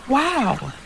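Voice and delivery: high, breathy amazed wow. Acted. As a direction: marvel at the castle. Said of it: "Elita One amazed to herself".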